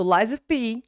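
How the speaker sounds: LIES OF P linked as lie-zov-P; connected words. Shown as a demonstration. Teacher shows linking, "lies of P" run together. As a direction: join "lies" onto "of" with a Z. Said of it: "you're going to connect".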